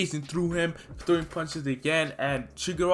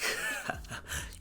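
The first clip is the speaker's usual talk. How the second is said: villain laugh; 12 dB quieter than usual. Retold or imitated. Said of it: "Shigaraki's like".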